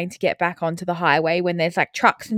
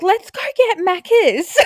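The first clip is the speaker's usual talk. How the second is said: mock cheerful distraction; 9 semitones higher, slower than usual. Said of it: "I was like".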